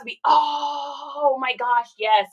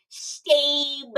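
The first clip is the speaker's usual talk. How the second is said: called name bit; 3 semitones higher than usual. Retold or imitated. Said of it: "Justin's in the other room".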